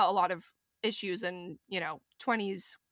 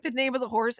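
normal talking voice

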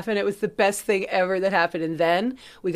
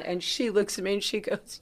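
ordinary talk, nothing marked

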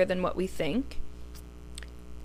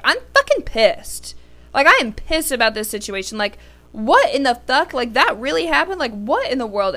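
angry outburst; 3 semitones higher than usual. Retold or imitated. Host retelling how angry she was yesterday, indignant venting. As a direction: retell being pissed, pitch jumping in disbelief. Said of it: "I was angry".

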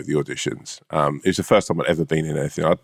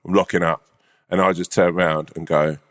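ordinary talk, nothing marked

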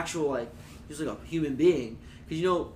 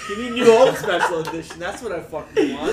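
excited laughing rant; 5 semitones higher, 10 dB louder than usual. Real, not acted. Excited rant about Star Wars.

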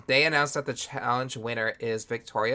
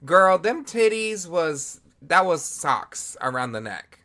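slow, higher and louder read, dragging it out; sassy drag-down; 6 semitones higher, 4 dB louder, slower than usual. Real, not acted. Co-host roasting bad costume breasts, slow sassy shade. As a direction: slowly savor the shade.

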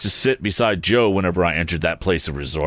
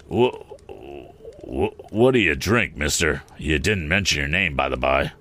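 low, sly, probing stranger; a low, cunning stranger; 4 semitones lower than usual. Acted. Stranger voiced by the narrator.

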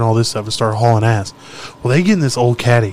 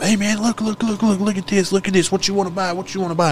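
higher, softer, insistent repeated pitch; imitated street hawker; 7 semitones higher, 5 dB quieter than usual. Dealers crowding a car window with their wares, imitated street hawker. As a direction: pester like a pushy seller, pitch held up.

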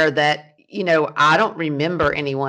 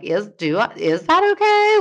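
high, doubtful self-question; unsure questioning; 7 semitones higher, 3 dB louder than usual. Retold or imitated. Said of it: "being like".

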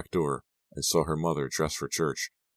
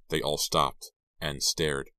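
ordinary delivery, nothing marked

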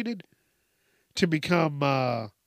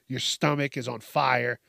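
ordinary talk, nothing marked

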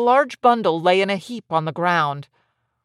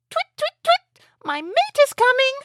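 very high, bird-like chirping voice; chirping swallows. A narrator's reading.

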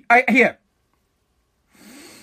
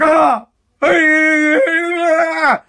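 mock panicked cry; 4 semitones higher than usual. Vlogger mocking politicians panicking at the Capitol, mock cry. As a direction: fake a flustered yelp. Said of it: "You're like".

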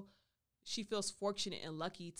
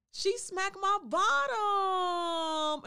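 high, loud, drawn-out holler; scandalized exclamation; 12 semitones higher, 11 dB louder, much slower than usual. Real, not acted. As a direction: holler it, stretching the last word way out. Exclaiming the unexpected climax.

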